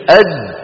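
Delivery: أد cut off hard with no breath after; held stop demo. Shown as a demonstration. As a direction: clamp shut on أد. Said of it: "طيب الجيم". (أد بحرف شديد محبوس)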